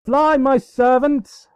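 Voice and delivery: high, loud, slow mock order; hammed-up command. Celestia ordering Vanilla away in hammed-up alarm. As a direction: bellow it like a tragic queen.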